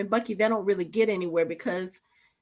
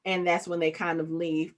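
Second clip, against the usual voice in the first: ordinary delivery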